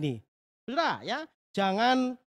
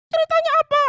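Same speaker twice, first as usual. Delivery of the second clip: put-on very high, loud eager child puppet voice; a curious little girl asking; Susan puppet voice; 11 dB louder, much faster than usual. Puppet girl Susan eagerly asks the pastor for the story, very high and loud. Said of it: "ini namanya Susan".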